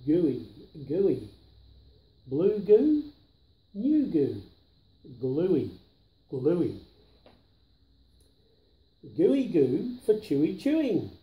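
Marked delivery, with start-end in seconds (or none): none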